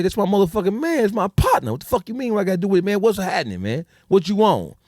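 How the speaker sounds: heated defense. Retold or imitated. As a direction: stand up for your partner, hot. Friend defending him, escalating.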